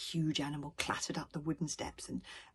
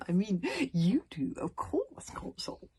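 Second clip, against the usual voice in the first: fawning backpedal; 4 semitones higher, 4 dB louder, slower than usual. A narrator's reading.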